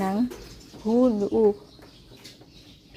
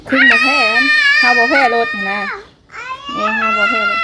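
playful cheering shout. Pretend. Mother cheers child on.

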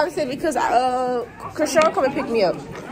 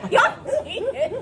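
high-pitched, laughing protest; laughing accusation; 8 semitones higher, much slower than usual. Real, not acted. Host to guessing players, laughing high accusation. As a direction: accuse them through real laughter, pitched way up.

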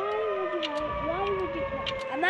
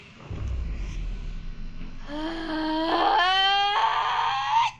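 anguished groaning wail; 2 semitones lower than usual. Real, not acted. Gamer groaning aloud after his team loses. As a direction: let out the pain of losing. Loss after AFK teammate.

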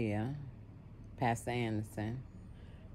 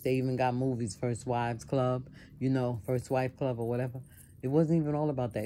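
ordinary talk

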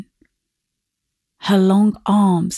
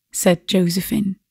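plain reading voice

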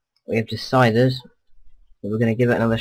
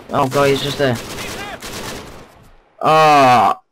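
panicked; 4 semitones higher, 6 dB louder than usual. Real, not acted. Struggles to aim at the enemy.

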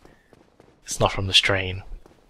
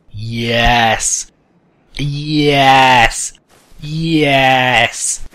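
loud repeated victory shouts; triumphant yells; 2 semitones higher, 8 dB louder, much slower than usual. Real, not acted. Gamer celebrating a hard-won success, shouting. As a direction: cheer each yes as it lands.

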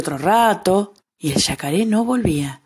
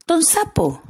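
tiny high flea voice, a bit higher; 10 semitones higher, slower than usual. Acted. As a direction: pitch up for a small insect. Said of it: "dijo la pulga".

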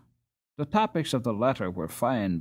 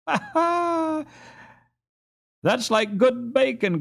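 put-on high laughing boy's voice; laughing cry; 14 semitones higher, slower than usual. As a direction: laugh out the joke. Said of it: "cried Ludwig".

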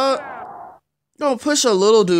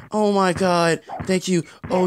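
normal talking voice

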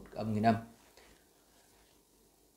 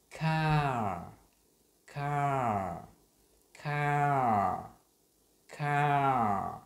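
"car" drawn out slowly, higher and louder; 3 semitones higher, 4 dB louder, much slower than usual. Shown as a demonstration. Teacher repeating car slowly for learners. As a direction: stretch each "car". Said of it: "mình đọc chậm".

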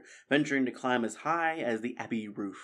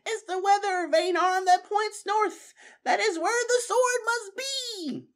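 put-on much higher, louder old mouse voice, slowing at end; Methuselah explaining; 5 dB louder than usual. Methuselah telling friends the sword's place, high certain voice. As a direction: announce the answer with certainty.

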